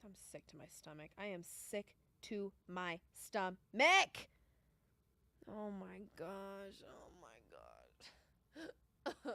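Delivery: slow, low groaning despair; sick and despairing. Real, not acted. Bettor slowly groaning to chat, sick over the bet. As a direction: drag it out, sick to the stomach. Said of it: "Feel myself getting emotional".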